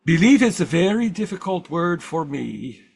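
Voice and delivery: imitating an old Swiss German speaker; Carl Jung Swiss accent. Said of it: "my best impression of Carl Jung with a Swiss accent".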